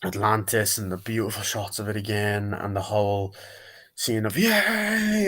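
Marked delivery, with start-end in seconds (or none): 4.4-5.2 high, drawn-out cheer; retold cheer. Fan acting out the opening scene's crowd, cheering. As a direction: imitate a crowd's high-pitched yay.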